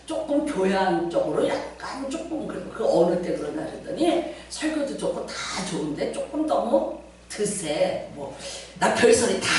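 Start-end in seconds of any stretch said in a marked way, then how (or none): 0.0-3.6 low, soft mock gossip; imitated critic. Preacher imitates members criticizing the pastor, low and soft. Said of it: "누가 또 뭐라 그러나". 4.5-8.1 softened, hesitant mock complaint; imitated critic. Preacher imitates members calling him too fierce, hushed. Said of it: "누가 또 뭐라 그러나".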